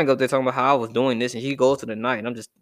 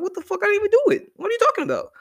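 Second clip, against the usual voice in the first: put-on very high-pitched, incredulous kid voice; indignant little kid; faster than usual. Falsely blamed kid to the accuser, high indignant protest. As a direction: squeal it like a kid blamed for nothing. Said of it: "when you're a little kid".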